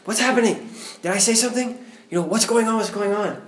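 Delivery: high, loud panicked questions; alarmed questions. Retold or imitated. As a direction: ask as if badly startled. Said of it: "freaking him out".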